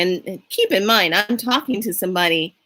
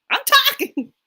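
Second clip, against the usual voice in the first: mock yell. Driver telling honking cars off, high mock yell. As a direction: squeal it like an interrupted driver. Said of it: "he turns around and yells".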